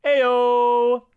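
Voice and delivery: loud, high, drawn-out hype shout; half-serious party-crasher holler, rising high; quasi Carl impersonation. Retold or imitated. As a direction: stretch it out and belt the final "Ayo". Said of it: "only a quasi Carl impersonation".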